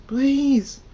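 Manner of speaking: high, loud frantic begging; desperate high-pitched screaming; screamed plea. A narrator's reading. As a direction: scream for your life. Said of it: "Cult Thunderstorm screams".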